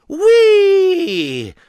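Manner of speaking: very high, loud sledging whoop; gleeful whee. Acted. Friends sliding downhill, loud high whoop. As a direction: whoop down the hill. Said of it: "they shouted together".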